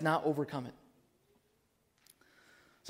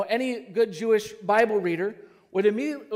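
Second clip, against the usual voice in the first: normal talking voice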